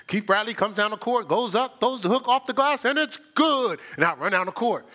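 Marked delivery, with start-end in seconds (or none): 0.1-3.8 lightly voiced play-by-play, climbing higher to the finish; mock announcer. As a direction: call your own move like a commentator. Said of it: "I used to do commercials".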